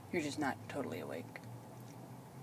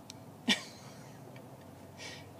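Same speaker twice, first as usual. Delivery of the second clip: breathy laugh; 4 dB quieter than usual. Real, not acted. Laughing at the cat remark.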